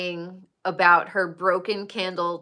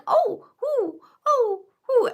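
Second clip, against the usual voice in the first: much higher squealing oh ooh; high-pitched mock squeal; high mock cooing; 14 semitones higher than usual. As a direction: squeal it high like Carnie teasing. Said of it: "'cause she's like".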